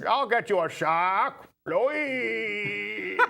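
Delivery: slow, drawn-out salty sea captain; Robert Shaw impression. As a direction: play it like the Jaws shark hunter, slowly. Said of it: "almost like Shaw".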